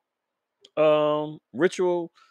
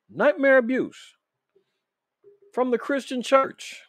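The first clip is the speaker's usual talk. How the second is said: higher and slow, stressing "Nightmare"; dramatic repeated headline; 8 semitones higher than usual. Acted.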